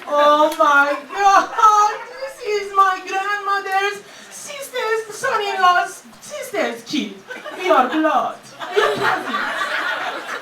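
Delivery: loud, drawn-out hammed-up outcry; mock dramatic shout. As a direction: overact the shocked family reunion. Mocking reality show cast drama.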